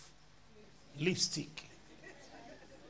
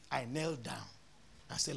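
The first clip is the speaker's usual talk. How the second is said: normal talking voice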